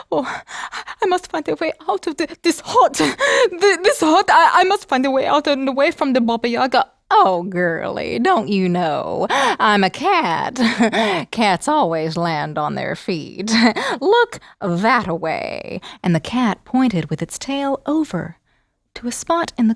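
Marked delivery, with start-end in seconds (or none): 0.1-6.9 higher, rushed frightened voice; girl begging the cat for help; anxious girl. Acted. Girl desperate. 7.1-15.8 slow drawling cat voice; cat teasing the girl; smug cat. Acted. Cat answers.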